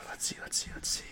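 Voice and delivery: soft voiceless muttering; barely audible thinking aloud; whispering to oneself. Real, not acted. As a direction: whisper absently while searching for an idea. Thinking to self while searching.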